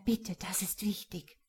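breathy whisper, urgent and secretive. Acted. Anna warning Mohammed amid the guests, whispered. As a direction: warn him under your breath so no one hears.

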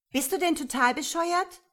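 high, loud outraged question; angry yell. A narrator's reading. Woman yelling at the man over cigarette butts. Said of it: "brüllte sie".

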